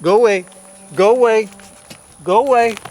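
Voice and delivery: firm loud shoo at cattle; calling out. Real, not acted. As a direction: drive the cows off, loud. Shooing cows away.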